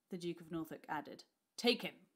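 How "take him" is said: loud, high barked command; barked order. A narrator's reading. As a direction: bark the order. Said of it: "he barked".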